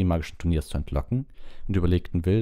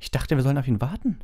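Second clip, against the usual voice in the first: high-pitched, affronted question; indignant; 9 semitones higher than usual. A narrator's reading. Hermine sees Hagrid leave without them, indignant. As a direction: ask it in higher-voiced outrage. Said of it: "sagte Hermine entrüstet".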